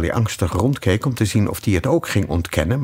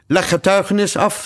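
king; 11 semitones higher, 3 dB louder than usual. Acted. King addresses the cook.

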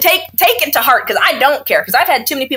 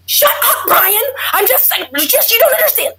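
high, exasperated snap at Brian; snapping at husband; 13 semitones higher than usual. Retold or imitated. Exhausted new mom snapping at her husband, high and exasperated. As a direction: bite it off like a worn-out mom losing patience. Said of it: "That snapping".

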